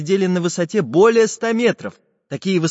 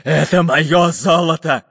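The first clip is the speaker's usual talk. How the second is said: loud, slow pirate grumble; Bul-Bul grumbling; 3 semitones higher, 6 dB louder, slower than usual. A narrator's reading. Pirate captain guards the chest, grumbling. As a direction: drag it out greedily. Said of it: "пробурчал капитан Буль-Буль".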